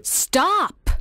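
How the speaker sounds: high, loud cry of protest; indignant outburst, pitch leaping up; sharp cry. Acted. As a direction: cry out to cut someone off. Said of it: "cried Virginia".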